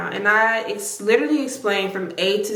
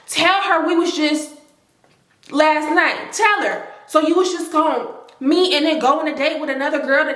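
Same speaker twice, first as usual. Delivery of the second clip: higher, pushy mock accusation; Keisha accusing; 6 semitones higher than usual. Other woman pressing the boyfriend to confess, a higher, pushy accusation. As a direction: jab each "tell her" at him.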